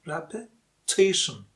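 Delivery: slow "Repetition", pausing between repe and TITION; split before stressed TI. Shown as a demonstration. Teacher breaks it down for learners, "Repetition" slowly split before TI. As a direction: break "Repetition" in two, leaning on TI. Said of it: "Again, the stressed syllable".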